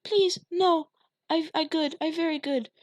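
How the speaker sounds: put-on high, loud child pleading; little girl begging her father; terrified begging. A narrator's reading. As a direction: beg for mercy. Polly begging.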